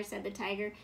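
plain reading voice

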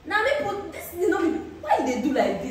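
normal talking voice

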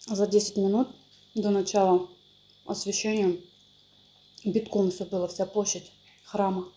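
eating between words; chewing while talking. Real, not acted. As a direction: mouth partly full. Seen 1: Woman bites food around +1.2–2.8, jaw chewing while speaking after.

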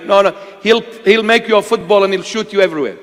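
ordinary talk

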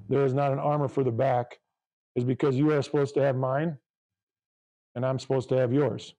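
ordinary talk, nothing marked

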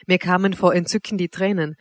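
usual speaking voice

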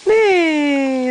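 put-on whinny like a storybook horse for baby; neighing horse. Reading to the baby, a high playful horse whinny. Horse sound in picture book.

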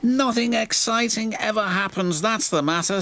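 high-pitched, fed-up little engine; bored engine moaning in a high voice; sighing Percy. A narrator's reading. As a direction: complain with a high, sighing whine. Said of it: "sighed Percy".

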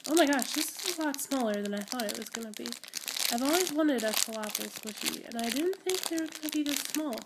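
usual speaking voice

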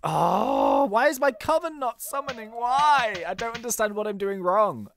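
ordinary talk

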